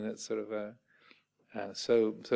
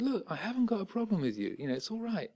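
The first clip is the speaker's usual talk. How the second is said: reassuring; 8 semitones higher, 4 dB quieter than usual. Retold or imitated. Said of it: "put his arm around this bloke and said".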